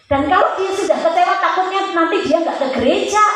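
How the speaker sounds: fervent preacher's exhortation; impassioned preaching. Real, not acted. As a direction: press the point home with high, emphatic force.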